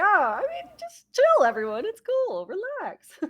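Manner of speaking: high, loud laid-back Muppet; chill green dog voice. Acted. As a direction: ham up the mellow Muppet. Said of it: "I am the green dog".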